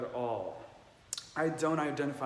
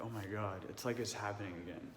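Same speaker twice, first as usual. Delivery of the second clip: hushed awe; 4 semitones lower, 8 dB quieter, faster than usual. Real, not acted. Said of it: "I'm getting the chills talking about it".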